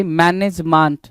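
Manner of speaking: slow man-ej-MAANT; misread management. Shown as a demonstration. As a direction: draw out मैनेजमेंट ending in "maant". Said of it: "क्या बोल रहा है भाई". (मैनेजमेंट में "मांट" खींचें)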